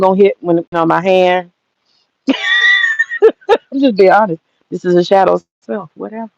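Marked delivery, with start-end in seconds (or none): 2.3-4.4 laughing through the words; slowed, giggling confession. Real, not acted. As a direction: laugh it out as you admit it.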